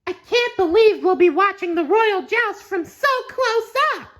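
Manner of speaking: high, loud thrilled sponge voice; excited SpongeBob. Acted. SpongeBob thrilled to watch the joust up close, excited. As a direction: gush with delight.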